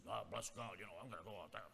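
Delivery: low, very soft acted retort; muttered bravado. Warned student says he will face the girls, muttered bravado. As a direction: mumble it low, as the defiant student.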